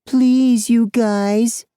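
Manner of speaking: mocking, very high squeaky voice; bully's falsetto mockery, drawn high and loud; squeaky girly mimicry. A narrator's reading. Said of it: "Fry mimics in a squeaky, girly voice".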